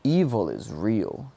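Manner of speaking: normal talking voice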